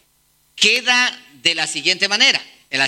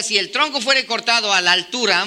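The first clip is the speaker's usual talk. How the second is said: normal talking voice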